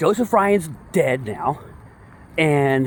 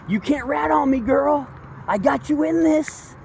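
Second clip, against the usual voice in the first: mock threatening husband; 8 semitones higher than usual. Voicing Banfield threatening wife.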